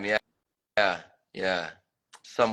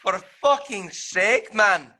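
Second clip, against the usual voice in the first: exasperated; 9 semitones higher, 4 dB louder, slower than usual. Real, not acted. Exasperated outburst in tutorial.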